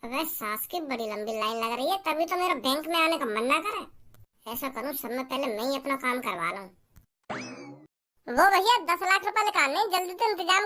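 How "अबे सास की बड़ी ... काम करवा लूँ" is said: soft, low mutter to himself; quiet aside about the long line. Acted. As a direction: grumble under your breath in the queue. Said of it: "talking to himself in bank".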